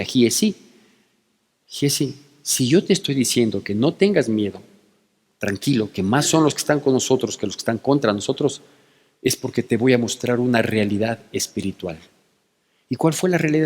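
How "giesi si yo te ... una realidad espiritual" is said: softer, gentle and unhurried; quiet the servant's fear kindly; calm reassurance. Retold or imitated. Eliseo soothing the frightened Giesi, soft and gentle. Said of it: "la tranquilidad del profeta Eliseo".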